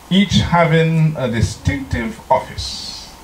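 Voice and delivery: slow, weighty reading of a sacred text; solemn reading. Acted. Preacher reads the Testimonies to the congregation, slow and solemn. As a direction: read it slow like scripture.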